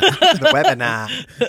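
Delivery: slow, high mock Australian "The webinar"; Australian accent. Friend echoing the phrase in Australian accent. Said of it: "with an Australian accent".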